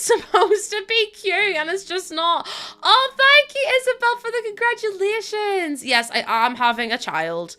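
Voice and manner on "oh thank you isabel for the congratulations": delighted. Real, not acted. Delighted thanks to a viewer.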